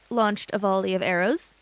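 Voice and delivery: usual speaking voice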